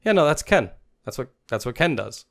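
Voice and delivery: loud then dismissive; unbothered shrug. Retold or imitated. Player next to him shrugging off the coach, dismissive. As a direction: brush it off like old news. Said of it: "he's like".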